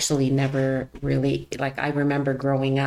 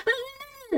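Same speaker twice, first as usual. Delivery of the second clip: mock exasperated ah; 6 dB quieter than usual. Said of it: "and she's going".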